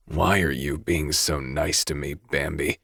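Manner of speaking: low, rough, quiet villain rasp. Acted. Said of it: "My voice is rougher".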